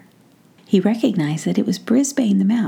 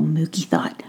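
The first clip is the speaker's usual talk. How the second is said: ordinary delivery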